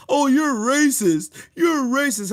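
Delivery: high, slow, sneering mimicry of critics; mock the accusers in a whiny voice; mocking whine.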